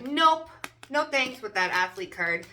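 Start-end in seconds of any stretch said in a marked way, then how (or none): 0.0-1.4 higher, louder dismissive nope; breezy refusal. Retold or imitated. Reader voicing the athlete card, breezy dismissive refusal. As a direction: wave it off. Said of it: "You're like".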